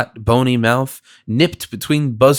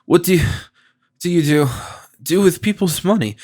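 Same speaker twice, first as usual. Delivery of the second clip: dizzy stammer; 5 semitones higher than usual. A narrator's reading. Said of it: "in a kind of vertigo".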